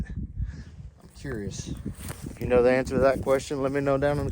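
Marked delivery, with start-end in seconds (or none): 0.1-1.7 drawn-out whispered musing; slow whisper. Real, not acted. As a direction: breathe it slowly, almost voiceless. Musing aloud to camera.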